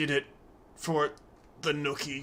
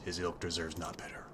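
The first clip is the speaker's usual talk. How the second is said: whisper; 4 semitones lower, 6 dB quieter than usual. Pretend. Reading NPC line in a hush.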